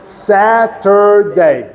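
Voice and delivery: slow SA-TUR-DAY, each syllable of Saturday held; three-syllable Saturday. Shown as a demonstration. Teacher stressing three syllables, slow Saturday demo. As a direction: sound out Saturday in three long beats. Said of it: "Este é três sílabas".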